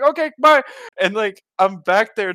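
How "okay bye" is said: very high mock-cheery farewell; hasty goodbye. Escaping buddy's mom to the bathroom, hasty goodbye. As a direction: chirp a quick escape. Acting out his quick goodbye.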